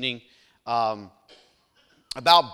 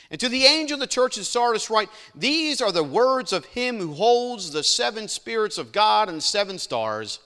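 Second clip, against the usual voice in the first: louder, proclaiming Bible reading; scripture reading; 4 semitones higher, 5 dB louder than usual. Acted. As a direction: read the verse out to the congregation. Reads scripture aloud.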